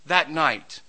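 ordinary talk, nothing marked

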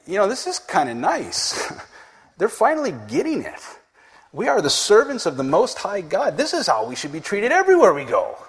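a hint of a pleased, flattered Paul; mock-satisfied apostle enjoying the worship; tempted apostle. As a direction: play the smug, tempted missionary. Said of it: "you'd probably be like".

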